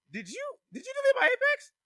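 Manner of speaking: high-pitched, stammering accusation; outraged imitation. Whitley accuses Cass of deleting Apex, high outraged imitation. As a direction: imitate her shrieking disbelief.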